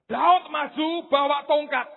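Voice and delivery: usual speaking voice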